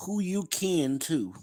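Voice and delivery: high, slow Southern drawl; high-pitched, unhurried Southern phrasing; Southern accent. Shown as a demonstration. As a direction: stretch it out like Southern folk. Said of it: "how they say down in the South".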